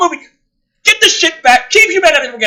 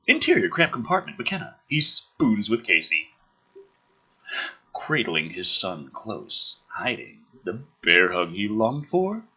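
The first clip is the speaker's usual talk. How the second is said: much softer, lower, hushed; hushed horror narration; 12 semitones lower, 4 dB quieter than usual. Acted. Reading the cryo tube hiding scene, hushed narration. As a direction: draw listeners into the dark. Reads screenplay action aloud.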